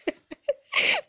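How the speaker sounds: a sudden high burst of laughter; a squeaky embarrassed giggle. Real, not acted. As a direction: giggle up high, caught off guard. Laughing over dad's gruff reply.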